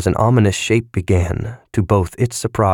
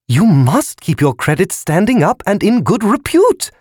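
very high squealing voice; squealing balloon; 13 semitones higher, 4 dB louder than usual. A narrator's reading. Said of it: "The balloon squealed".

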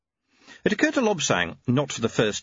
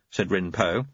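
ordinary talk, nothing marked

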